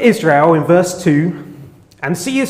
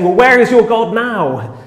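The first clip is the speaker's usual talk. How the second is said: louder, higher mock jab; taunting jibe; 7 semitones higher, 5 dB louder than usual. People mocking believers in tragedy, a raised taunt. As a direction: throw it at them as a jeer. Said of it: "Not in a genuine questioning way".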